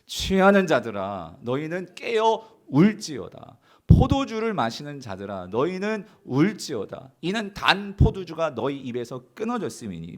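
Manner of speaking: slow, deliberate reading of scripture; scripture reading aloud. Real, not acted. Pastor leading the church in reading the verse aloud, slow and deliberate. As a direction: read the verse slowly to the congregation. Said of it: "시작".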